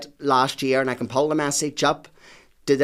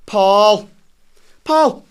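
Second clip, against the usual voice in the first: loud, high call, rising with each repeat; 7 semitones higher, 9 dB louder, much slower than usual. Pretend. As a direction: holler for your brother offstage. Calling out for his brother.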